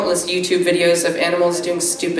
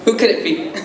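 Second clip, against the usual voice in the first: usual speaking voice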